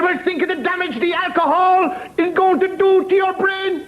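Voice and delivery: high, insistent nun's lecture; preaching nun. Acted. Nun lectures drinker about alcohol, high-pitched preaching. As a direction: warn him in a high moralizing wail.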